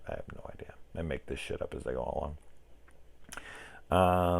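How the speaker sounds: ordinary talk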